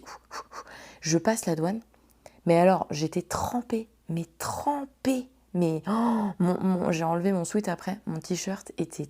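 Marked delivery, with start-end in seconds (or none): 0.0-0.6 soft voiceless ouf ouf ouf; hushed sighs of relief; whispered relief. Retold or imitated. Relief as officer smiles. 4.1-5.3 trempée stretched slowly and pitched higher; exaggerated, slow; drawn-out emphasis. Real, not acted. Stressing how soaked she was.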